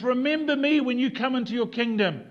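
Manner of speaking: loud, high desperate plea; crying out. Acted. Dying thief begs Jesus, crying out. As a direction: call out with full force. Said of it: "He cries out to Jesus".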